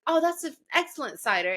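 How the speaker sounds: high-pitched mock British voice; terrible British accent. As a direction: imitate a British bartender, badly. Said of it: "that's my British terrible accent".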